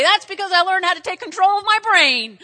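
loud, high, proud proclamation; triumphant declaration. Acted. Patient answering the puzzled doctor, loud and triumphant. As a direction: proclaim it at full preaching force.

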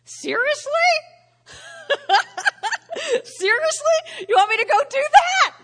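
high, loud, laughing disbelief; incredulous Abraham. Retold or imitated. Abraham reacting to Sarah's plan, high laughing disbelief. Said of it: "Abraham's probably thinking".

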